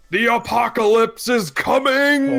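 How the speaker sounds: high, slow street-preacher holler; doomsday shouting. Acted. Bedraggled man warns the street of the apocalypse, shouting. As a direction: cry it out to the whole street, drawn out. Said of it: "he's shouting".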